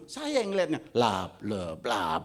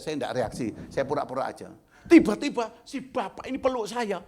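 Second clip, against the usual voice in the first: ordinary talk, nothing marked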